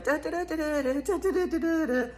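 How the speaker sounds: high, light da-da-da filler; sing-song driving noise. Pretend. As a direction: chant a little driving ditty. Hums while acting out the drive.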